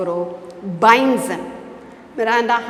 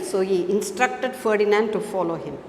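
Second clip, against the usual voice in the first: usual speaking voice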